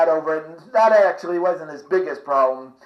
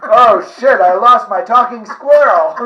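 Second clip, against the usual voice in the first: mock alarm; 3 semitones higher, 7 dB louder than usual.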